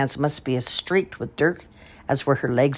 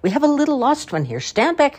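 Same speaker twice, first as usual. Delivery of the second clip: teasing leader; 11 semitones higher than usual. A narrator's reading. Kolya the village leader teases the mothers, high and playful. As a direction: tease the crowd with mock gravity. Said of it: "Kolya would say to her".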